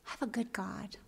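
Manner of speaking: hushed whisper of quiet faith. Retold or imitated. Widow at her husband's funeral, soft whisper. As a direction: breathe it softly like a secret.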